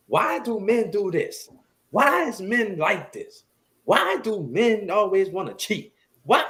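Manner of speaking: high-pitched, drawn-out accusing questions; mock complaint. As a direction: imitate an exasperated complainer, slow and high. Acting out accusing questions about men.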